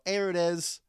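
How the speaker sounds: put-on Baltimore accent. Host imitating himself watching the Ravens, Baltimore accent. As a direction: call it out with Baltimore vowels. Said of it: "the accent does come out".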